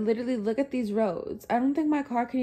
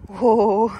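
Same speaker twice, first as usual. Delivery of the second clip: gasping in icy wind; 7 dB louder than usual. Real, not acted. Slipping in snow.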